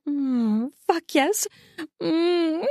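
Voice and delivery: high, moaned assent; mumbled agreement. A narrator's reading. Woman agreeing with her lover, mumbling. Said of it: "She mumbles in agreement".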